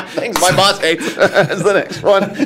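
giggling through the words; laughing. Real, not acted. Joking, laughing through line.

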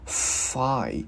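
FIND begun with a long, airy F, no ending yet, "fffai"; strong airflow. Shown as a demonstration. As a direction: push lots of breath through the F of "Find". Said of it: "mình đưa cái hơi nhiều ra". ("Find": bật nhiều hơi ở âm F)

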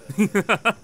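short burst of laughter; gleeful laugh. Real, not acted. Gamer watching his village burn, gleeful laugh.

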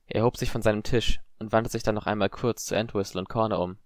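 usual speaking voice

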